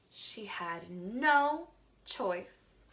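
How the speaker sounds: ordinary talk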